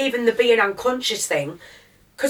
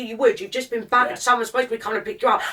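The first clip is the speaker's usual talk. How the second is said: ordinary talk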